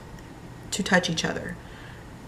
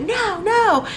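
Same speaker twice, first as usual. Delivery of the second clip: put-on much higher, whiny little-kid no; cranky toddler; 13 semitones higher, 3 dB louder than usual. Mom imitating her cranky daughter refusing, high and whiny. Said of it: "from being cranky and being like".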